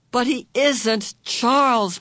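high, loud and slow anguish; anguished cry. A narrator's reading. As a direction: plead in desperate anguish. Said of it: "Meg cried in anguish".

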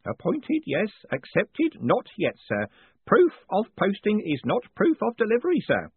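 put-on high, eager old postman. Groat explaining the initiation.

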